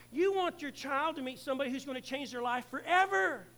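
loud, rising sermon push; preaching. Real, not acted. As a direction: drive it home like a revival preacher. Pastor preaching at full force.

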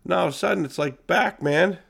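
ordinary talk, nothing marked

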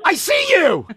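high excited call; thrilled to spot her on camera. Real, not acted. Host spots diver on cam.

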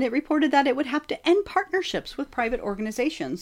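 ordinary talk

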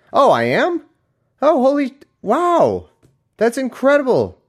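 high, loud God feigning astonishment; mock amazement. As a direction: gush with exaggerated, sarcastic wonder. Mock God hearing good news.

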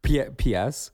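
laughing while talking. Real, not acted. Laughing after partner's joke.

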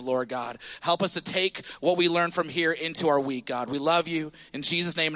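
low, earnest prayer voice. Real, not acted. Pastor praying over the congregation, low and earnest. Closing prayer before sermon.

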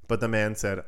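normal talking voice

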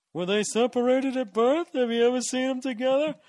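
high, loud hacky comic bit; cheesy eighties comic voice. Acted. Comic mocking his own eighties joke in a hacky voice. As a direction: ham it up like a cheesy eighties comedian.

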